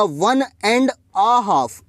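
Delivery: very slow, each word drawn out; slow model phrase. Shown as a demonstration. Teacher showing students the English phrase, very slow. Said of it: "English में कैसे बोलूँगा".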